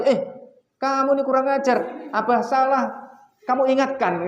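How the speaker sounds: high, loud mock scolding; hammed-up angry father telling off. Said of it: "Bukan saya marahi". (omelan pura-pura, tinggi dan keras)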